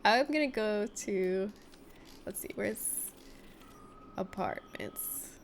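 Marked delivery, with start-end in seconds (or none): 2.2-5.3 whispered muttering. Real, not acted. Muttering to self while searching.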